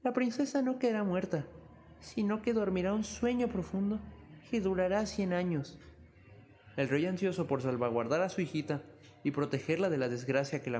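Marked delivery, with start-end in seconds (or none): 0.0-5.8 high, gentle wise woman; kind fairy voice. Acted. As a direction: soften the curse like a good fairy. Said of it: "se adelantó y dijo".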